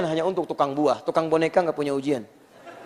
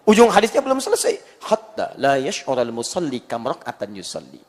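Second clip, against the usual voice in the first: ordinary talk, nothing marked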